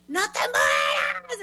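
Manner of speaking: high, drawn-out mock-hysterical wail; frantic panic. Woman begging her fainted friend to live, frantic. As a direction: re-enact a panicked plea, stretching it out high. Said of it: "en loca, o sea, yo me asusté mucho".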